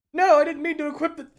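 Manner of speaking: high, loud protest; real flustered cry; flustered outburst. Real, not acted. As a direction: blurt out the mistake. Misclick reaction.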